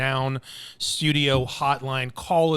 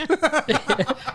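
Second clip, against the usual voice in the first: short high burst of laughter; 12 semitones higher than usual. Real, not acted. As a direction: let out a giggle. Joking about gross food.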